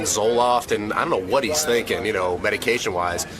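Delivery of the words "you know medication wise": ordinary delivery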